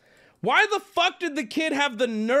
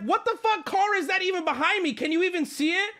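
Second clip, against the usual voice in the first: much higher, disbelieving; high incredulous rant; 9 semitones higher than usual. Retold or imitated. Customer asking the worker which car, outraged and high. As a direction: demand an answer in a squeaky outrage. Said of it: "employees heard me yelling".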